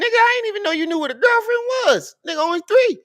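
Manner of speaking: high amused surprise at the son; playful disbelief. Real, not acted. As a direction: tease him in amused disbelief. Dad teasing son about girlfriend.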